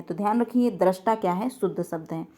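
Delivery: ordinary delivery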